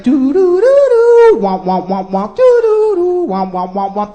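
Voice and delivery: wobbling sci-fi machine noises, sung out; cartoon sound effects. Comic mimicking bionic breasts inflating, cartoon sound effects.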